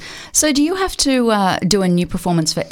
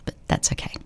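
soft, breathy aside; whisper; 10 dB quieter, faster than usual. Acted. Host shrugging off listeners who dislike her, whispered aside. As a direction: drop to a near-silent whisper.

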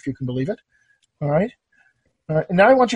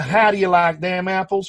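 hah di ya lahk them apples, broad Southern accent. Shown as a demonstration. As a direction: go full country with the line. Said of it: "if we were gonna".

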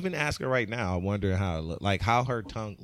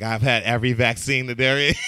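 proud boast; 8 dB louder than usual. Retold or imitated. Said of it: "He's like".

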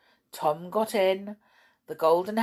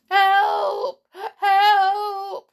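high, loud desperate cries for help; stranded doll shouts for help; shouting for help; 14 semitones higher, 9 dB louder than usual. Acted. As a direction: scream for help from the rock. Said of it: "she shouted".

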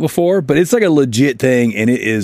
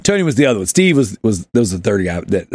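normal talking voice

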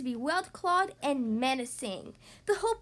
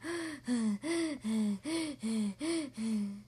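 heavy rhythmic mouth-breather panting; monster panting; 3 semitones lower, 3 dB quieter than usual. A narrator's reading. Monster shows off his mouth breathing to scare the child, panting. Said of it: "Herbert's panting was kind of scary".

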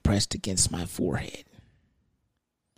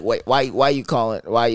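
normal talking voice

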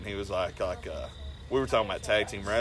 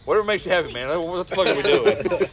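exasperated disbelief; 6 semitones higher, 7 dB louder than usual. Retold or imitated. To his partner mid-match, exasperated laughing disbelief. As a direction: huff it out in disbelief, half laughing.

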